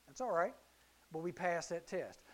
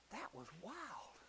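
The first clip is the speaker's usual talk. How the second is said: hushed amazement; 18 dB quieter, much slower than usual. Acted. Disciples in unison amazed.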